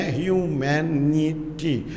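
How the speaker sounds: ह्यूमैनिटी slowly in four separate syllables. Shown as a demonstration. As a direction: break ह्यूमैनिटी into four deliberate beats. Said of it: "वो उसका syllable कहलाएगा". (ह्यूमैनिटी के चारों syllable अलग-अलग)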